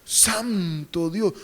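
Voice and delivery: hammed-up lovestruck awe; smitten awe. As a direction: breathe out mock adoration, slowly.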